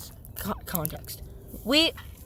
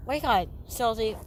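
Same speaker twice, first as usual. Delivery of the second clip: ordinary talk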